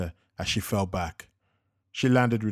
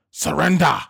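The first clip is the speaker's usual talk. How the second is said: loud, higher threatening scream; screamed demand; 6 semitones higher, 7 dB louder than usual. A narrator's reading. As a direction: scream it over the gun barrel. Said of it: "he screamed".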